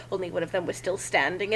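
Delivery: ordinary delivery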